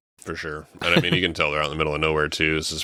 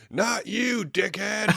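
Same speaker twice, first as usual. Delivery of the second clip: high mocking voice; slower than usual. Mocking the character's line.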